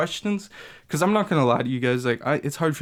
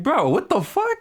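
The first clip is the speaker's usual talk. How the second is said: disbelief; 13 semitones higher than usual. Retold or imitated. Exasperated mock reaction to school.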